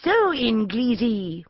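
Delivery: slow, menacing bandit leader; snarling villain. Acted. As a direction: draw it out like a sneering desert chieftain. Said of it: "he snarled".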